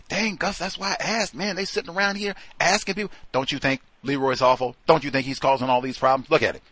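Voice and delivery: high, fast gossipy coworker; mock coworker voice. Coworker whispering gossip about Leroy, high and fast. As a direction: ham up a nosy coworker stirring trouble.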